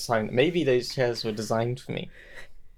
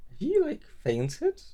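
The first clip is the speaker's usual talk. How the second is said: retold worry; slower than usual. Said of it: "my mind went to this really dark place".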